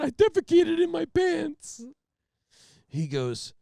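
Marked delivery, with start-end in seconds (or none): 0.0-1.8 high, loud, slow blubbering; hammed-up tearful confession; mock sobbing confession. Repeating the confession to the trooper, blubbering.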